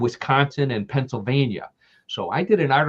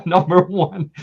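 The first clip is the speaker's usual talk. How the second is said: laughing; 7 semitones higher, 6 dB louder than usual. Real, not acted. Host tickled by his own beer pitch to aliens, laughing. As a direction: say it through a loud, high laugh.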